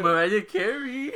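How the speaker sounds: giggling through the name; laughing it out. Real, not acted. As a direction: say it while cracking up. Laughing retelling her joke.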